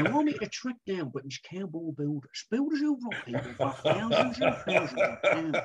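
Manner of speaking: a hint of Dominic Littlewood chasing a rogue; Dominic Littlewood impression. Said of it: "you didn't do Dominic Littlewood tonight".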